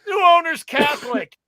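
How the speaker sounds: high, loud retort; acted loud answer. Guy at the urinal answering the boy, acted loud retort. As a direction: call it out as a punchline.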